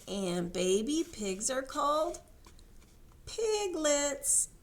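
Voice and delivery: ordinary delivery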